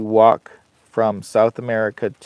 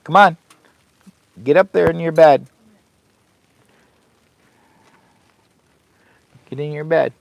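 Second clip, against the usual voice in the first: firm dog command; 6 semitones higher, 6 dB louder than usual. Real, not acted. Ordering the dog to bed.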